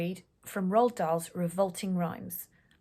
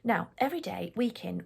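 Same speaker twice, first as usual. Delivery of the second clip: usual speaking voice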